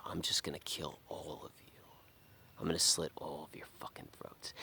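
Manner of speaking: whispered, fading to near silence; sinister whisper. Retold or imitated. The inner psychopath speaking to himself, chilling whisper. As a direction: hiss it like a dark inner voice. Voicing urge to kill family.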